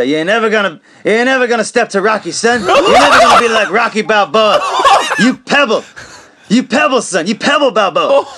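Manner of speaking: high, loud taunting through laughter; hammed-up trash talk at a rival; laughing while mimicking an insult; mock taunting. Smolik's retold taunting insult.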